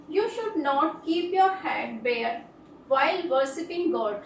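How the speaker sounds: very slow, every word spaced out; slow dictation. Shown as a demonstration. Teacher reading an example sentence very slowly to students. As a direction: read it out slowly so students can write it down.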